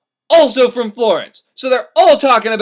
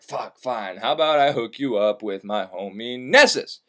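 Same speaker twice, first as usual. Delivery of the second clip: much lower and softer, a put-on low voice; grudging centaur; 9 semitones lower, 4 dB quieter than usual. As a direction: grumble it like a reluctant brute. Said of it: "the centaur's like".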